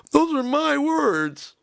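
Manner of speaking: high, slow, drawn-out protest; indignant protest. Acted. Singer claiming his lyrics, mock indignant.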